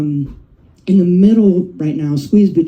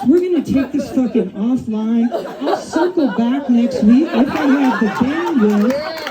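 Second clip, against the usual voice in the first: higher, breezy buzzword-spouting manager voice, laughing through it; corporate middle manager; 5 semitones higher than usual. Retold or imitated. Middle manager cutting off the webinar, higher, chirpy and amused. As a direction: play the upbeat boss wrapping up the meeting. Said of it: "And I'm like".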